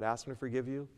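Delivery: ordinary talk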